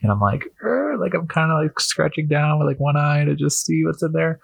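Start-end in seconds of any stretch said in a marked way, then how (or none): 0.6-1.0 nervous high grunt. Retold or imitated. Prying open dusty trunk nervously.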